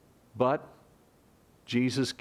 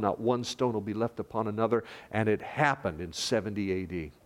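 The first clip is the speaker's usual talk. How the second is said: ordinary delivery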